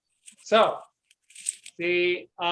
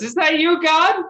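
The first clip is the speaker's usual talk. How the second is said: higher, louder, awed call to the sky; startled peasant; 7 semitones higher, 6 dB louder than usual. Acted. Peasant hears a voice from nowhere, higher and louder in awe. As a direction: ask it as if heaven itself answered. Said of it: "peasant man looks around, goes".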